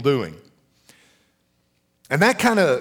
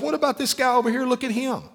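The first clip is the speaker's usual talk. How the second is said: high, whining mock complaint; whiny complainer; 9 semitones higher, faster than usual. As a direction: act the jealous believer, whining. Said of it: "We start saying".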